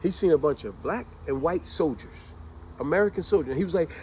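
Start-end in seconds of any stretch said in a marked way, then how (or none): none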